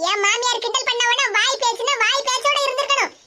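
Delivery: normal talking voice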